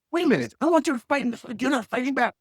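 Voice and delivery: hammed-up indignant heckler wanting a fight; voicing the insulter. Cusser voiced demanding a fight.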